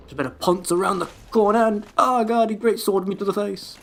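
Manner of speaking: mock enemy inner voice. Gamer mocking a wraith's thoughts, mild pompous voice. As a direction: narrate the wraith's thoughts as a self-important fool. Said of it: "You not think".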